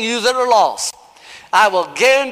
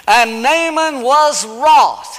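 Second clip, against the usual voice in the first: slow scripture reading; 4 semitones higher, 3 dB louder, much slower than usual. Acted.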